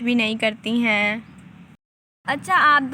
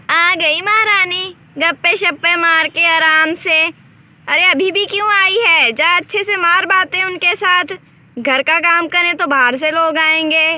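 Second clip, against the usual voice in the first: loud, higher, sarcastic scolding; angry taunting; 4 semitones higher, 5 dB louder than usual. Acted. Mother-in-law berates late Shalu, loud sarcastic scolding. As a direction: taunt her loudly for coming late.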